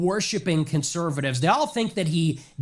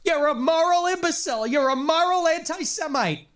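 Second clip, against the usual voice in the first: much higher put-on caricature voice; a mocking caricature of a pundit, pitched much higher; mocking Ben Shapiro; 11 semitones higher than usual. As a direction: lampoon him in a high, mocking voice. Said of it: "and says".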